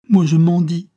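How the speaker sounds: high, loud retort; impassioned cry. Acted. Chiffon outdoing the man's poverty, crying out. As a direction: throw it back as a loud protest. Child protests loudly.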